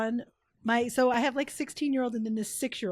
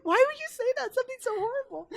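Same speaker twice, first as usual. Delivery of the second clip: shocked; 12 semitones higher, faster than usual. Retold or imitated. Stranger reacting to baby talk, high and shocked. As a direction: gasp it out, appalled.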